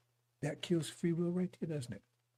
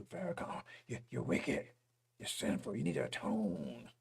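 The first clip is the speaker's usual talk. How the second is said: lower, hushed mocking of the accusers; mock accusing voice; 5 semitones lower than usual. Preacher mocking critics of Farrakhan, in a low sneering voice.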